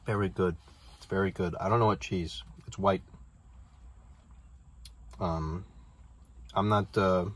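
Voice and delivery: chewing while talking; words muffled by food; mouth full of food. Real, not acted. Seen 2: bite taken just before; jaw working, wrap held throughout.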